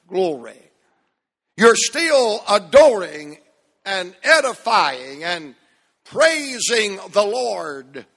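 ordinary talk, nothing marked

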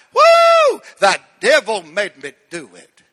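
loud mock TV preacher catchphrase; Reverend Flip impression. Repeating the TV bit for the congregation, loud whoop. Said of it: "Anybody remember Reverend Flip?".

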